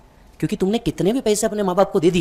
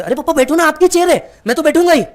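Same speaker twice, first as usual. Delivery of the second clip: much higher, louder pleading insistence; son insisting; 11 semitones higher, 6 dB louder than usual. Retold or imitated. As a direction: coax him into the chair, pitch way up. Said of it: "आप बोलते".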